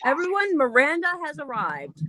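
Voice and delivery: high-pitched herald's proclamation; playfully pompous arrival call; mock grand announcement.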